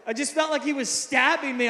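ordinary delivery

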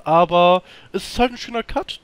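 normal talking voice

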